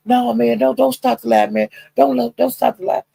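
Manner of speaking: high pleading voice; pleading friend. Retold or imitated. Friend pleads with Broadway not to stop the live, high. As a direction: beg him high not to end it. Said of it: "and he said".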